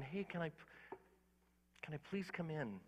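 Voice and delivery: soft, quiet asking voice; gentle plea. Retold or imitated. Jesus softly asking to come in at the door. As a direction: ask it softly, like knocking politely.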